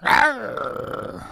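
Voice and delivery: a sudden high mock animal growl; a silly little roar tossed to the audience; playful growl. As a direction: growl at them like a cartoon beast. Host growls at his listeners.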